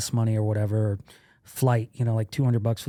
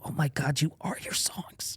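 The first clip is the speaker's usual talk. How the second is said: hushed, half-whispered amazement; breathy soft realization, acted out; hushed awe; 3 semitones higher, 9 dB quieter than usual. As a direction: whisper it like a revelation. Awed aside inside retold intimate talk.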